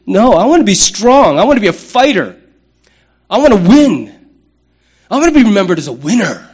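a preacher voicing the guy who wants to win, loud; tough guy boast. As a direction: pound out each want like a fighter. Tough guy's boast voiced.

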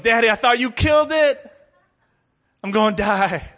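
put-on high, loud frantic child's cry; panicked child. Boy cries to father as bee escapes, panicked. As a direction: wail like a terrified little boy.

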